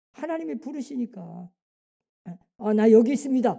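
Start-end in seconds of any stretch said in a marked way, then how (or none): 2.6-3.5 bold reply to God. Acted. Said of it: "하고 나와야 되는데".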